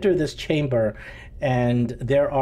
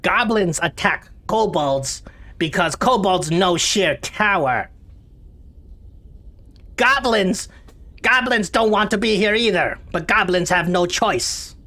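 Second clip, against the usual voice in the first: high, whiny goblin voice; 7 semitones higher, 3 dB louder than usual. Acted. Snoggy explains the goblin war, high and whiny. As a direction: plead that you had no choice.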